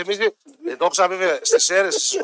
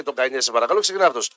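normal talking voice

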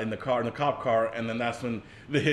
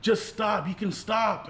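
much higher-pitched mock pleading; imitated plea; 8 semitones higher, slower than usual. Grace begging the hitcher to stop, imitated plea. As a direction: plead in a raised, put-on voice.

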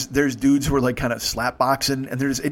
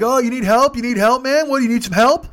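loud, high-pitched mock offer; imitating young hecklers offering help; jeering bystanders; 10 semitones higher, 7 dB louder than usual. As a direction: call out like a rowdy onlooker.

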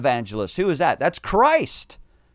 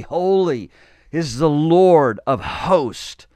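louder, rising proclamation of holy; crying out; 3 semitones higher, 6 dB louder, slower than usual. Acted. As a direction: cry out holy like the seraphim. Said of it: "And they cried one to another".

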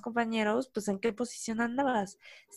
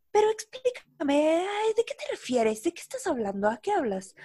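exasperated questioning; 6 semitones higher than usual. Retold or imitated. Boss grilling an employee in her office, mock exasperation. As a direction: pester with baffled questions, pitch jumping up at first.

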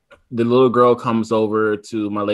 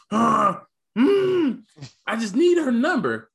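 put-on much higher, nervous kid squeak; nervous boy squeak, pitched way up; squeaky excited kid voice; 12 semitones higher than usual. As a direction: imitate a son's cracking high voice. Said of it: "my voice goes high".

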